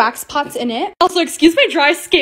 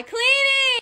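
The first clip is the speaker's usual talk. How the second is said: high chirp; 10 semitones higher, 4 dB quieter than usual. Real, not acted. Sing-song cleaning cheer.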